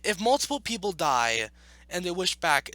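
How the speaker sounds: ordinary talk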